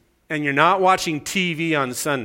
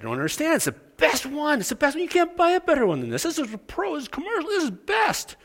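squeaky insistence; 12 semitones higher than usual. Retold or imitated. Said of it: "Guy said".

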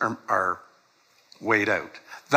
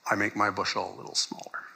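fast conspiratorial whisper; 3 semitones lower, 7 dB quieter, faster than usual. Real, not acted. Preacher whispers to the congregation about cheating. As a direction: whisper it like a secret. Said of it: "I don't know why I'm whispering".

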